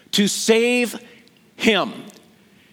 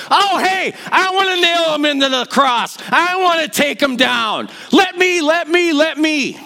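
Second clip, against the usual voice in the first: shouting from the crowd; 6 semitones higher than usual. Retold or imitated. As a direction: yell it out, hungry to join in. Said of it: "he would have been shouting in the audience".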